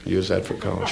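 muttered low and soft in disbelief; mock echo. Comedian echoing the parents' meagre tip, muttered low. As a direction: repeat it under your breath, scornfully. Repeated mockingly, low and quiet.

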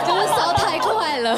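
laughing through the words, drawn out. Real, not acted. 自嘲反应太快，全场笑. (笑着说)

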